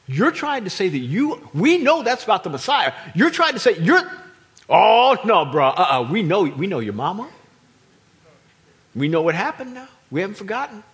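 loud, indignant heckling; outraged crowd. Retold or imitated. Synagogue crowd heckles Jesus in his hometown, loud and indignant. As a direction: shout him down, scandalized.

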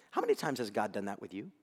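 ordinary talk, nothing marked

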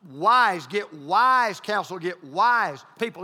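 "wise" punched loud and slow; stress on wise. Shown as a demonstration. Pastor drilling home the word to his listeners, "wise" loud and slow. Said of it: "I put the emphasis on wise".

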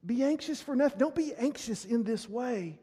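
ordinary talk, nothing marked

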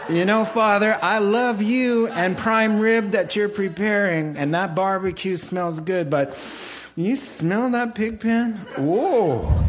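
high, laughing, hammed-up longing for the pig pen; wavering son. The torn son tells his father he misses the pig pen, laughing. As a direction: tease the son's craving for filth. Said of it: "if the kid kept saying on the road".

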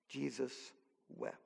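Jesus wept, nearly whispered with awe; hushed and solemn. Shown as a demonstration. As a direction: let it fall almost to a breath. Solemn reading of the short verse.

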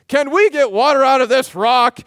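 loud, high rallying call; rally cry. Retold or imitated. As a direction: rouse the crowd to cheer. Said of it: "giving off a rally cry".

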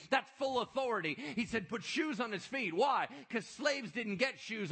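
loud, high driving sermon; preaching at full force. Acted. Preacher explaining the robe, ring and shoes, full force. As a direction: hammer home the father's welcome.

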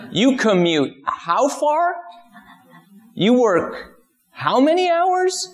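high, stunned outsider voice; astonished ancient teacher. Retold or imitated. Ancient teacher transported to the Bay Area, high and stunned. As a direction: gape at modern life in disbelief. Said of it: "Can you imagine if he was transported here".

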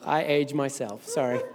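usual speaking voice

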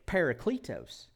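parakletos as PAIR-uh-klee-toes in a Midwestern accent; parakletos, playful Midwestern accent. Shown as a demonstration. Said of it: "in the Midwestern dialect".

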